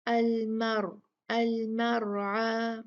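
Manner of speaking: slow recitation with the ra in अलमरआ heavy and full. Shown as a demonstration. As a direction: stretch अलमरआ slowly, ra thick. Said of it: "इसको भारी पढ़ा जाएगा".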